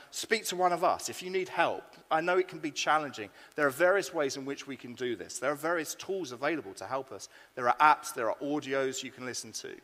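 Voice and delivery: usual speaking voice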